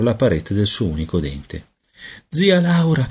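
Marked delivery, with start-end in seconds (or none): 2.3-3.1 cautious, high-pitched small voice; cauto. A narrator's reading. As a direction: address her carefully, pitched up. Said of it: "disse cauto". (voce acuta, cauta)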